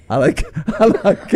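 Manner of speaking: a teasing put-on English accent through laughter; mock English accent. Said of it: "they put on English accents".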